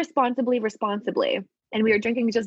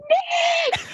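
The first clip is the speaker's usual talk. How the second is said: much higher, loud laughing shout; scream out the window; 4 dB louder than usual. Retold or imitated. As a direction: yell the name out a car window. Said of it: "you just scream out the window".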